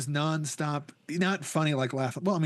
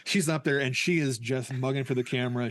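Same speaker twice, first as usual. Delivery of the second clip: normal talking voice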